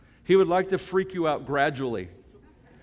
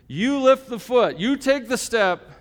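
slow, higher and louder, a lightly voiced gentle father God; 8 semitones higher, 6 dB louder, slower than usual. God urging him up the hill, slow and firm. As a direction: speak each step out slowly like a father urging his son. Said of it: "he says".

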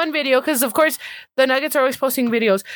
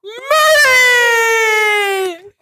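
loud, high, drawn-out arena introduction; hype announcer; 6 semitones higher, 5 dB louder, much slower than usual. Pretend. Introducing a favorite player to the crowd, hammed-up announcer. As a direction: build to a long shouted name. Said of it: "I should be an announcer".

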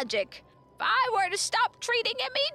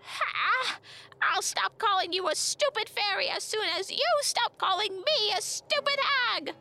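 high-pitched hag screech; screeching back; 13 semitones higher, 4 dB louder than usual. A narrator's reading. Old woman trading insults with a fairy, screeching back. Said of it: "The second voice screeched back".

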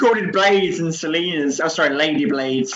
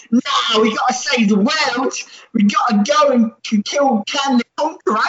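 higher mock pleading; 7 semitones higher than usual. Korg urging to save world.

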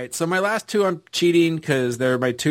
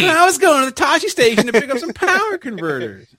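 put-on high whiny young man voice; whiny Luke; 13 semitones higher, 3 dB louder than usual. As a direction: whine it like a petulant farm boy. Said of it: "it's whiny Luke".

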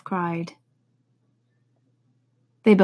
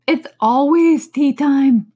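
sighing; 7 semitones higher, 9 dB louder, slower than usual. Acted. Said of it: "with a sigh".

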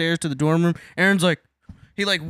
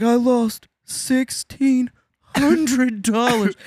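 put-on drunk whining friend; 7 semitones higher, much slower than usual. Drunk friend crying over money.

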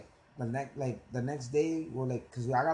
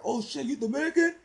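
much higher surprised cop; 13 semitones higher, 5 dB louder than usual. Retold or imitated. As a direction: sound caught off guard. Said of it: "he was like".

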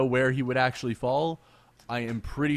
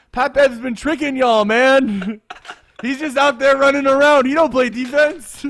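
much higher and louder, laughing through it; hyped roast; 10 semitones higher, 11 dB louder than usual. Real, not acted. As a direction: call him out, fired up. Hyped roast of Pat Bev.

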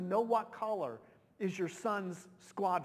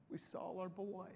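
softer, fading and choked; emotional; 7 dB quieter than usual. Real, not acted. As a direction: let it sink in, falling away quietly. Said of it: "I still just get so emotional".

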